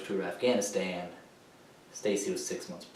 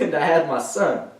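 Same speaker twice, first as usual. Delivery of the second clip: raised voice, higher and strong; fervent declaration; 4 semitones higher, 4 dB louder than usual. Acted. Veteran naming what kept him going, raised and fervent. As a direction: declare it with full feeling. First take, still holding up.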